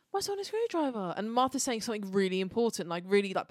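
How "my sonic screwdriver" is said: high squeaky mock whine; imitating the Doctor. Friend mocks the Doctor's whine over his lost sonic. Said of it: "He's like".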